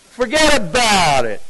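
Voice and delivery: punchy New Jersey catchphrase; New Jersey accent. Shown as a demonstration. Preacher showing how they talk back home, New Jersey accent. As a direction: toss it out like a New Jersey local. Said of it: "That's how they talk".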